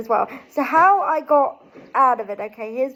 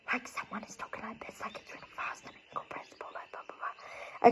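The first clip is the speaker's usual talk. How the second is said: rapid, incomprehensible whisper; fast whispering; 3 semitones lower, 15 dB quieter, faster than usual. Shown as a demonstration. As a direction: whisper it very fast, words blurring into chatter. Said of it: "really fast whispering".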